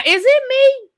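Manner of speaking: squeaky high, mock-innocent is it me; petty high question. Host getting petty about Stormi with a high mock-innocent question. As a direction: ask it sky-high, playing innocent. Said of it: "I'm about to be a little petty".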